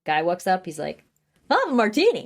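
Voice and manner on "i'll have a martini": mock customer voice. Customer quoted in recap.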